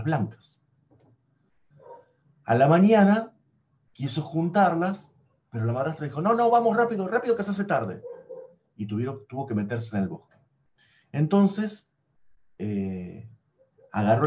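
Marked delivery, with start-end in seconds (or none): 6.2-8.0 hurrying stepmother. Acted.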